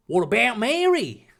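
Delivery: high, put-on indignant voice; angry challenge. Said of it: "Ronald posited angrily".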